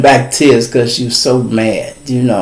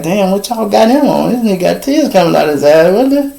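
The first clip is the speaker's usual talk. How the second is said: higher, quick disbelief; shocked onlookers; 8 semitones higher than usual. Retold or imitated. People in the jail shocked at his tears, high quick disbelief. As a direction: gasp at the crying man.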